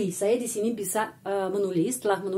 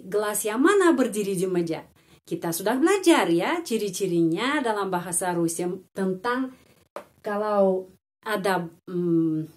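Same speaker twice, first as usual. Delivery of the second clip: ordinary talk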